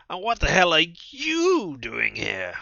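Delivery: loud, high and slow angry shout; high shrill voice. Acted. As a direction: screech it at the intruder. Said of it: "I never expected her to have a high, shrill voice".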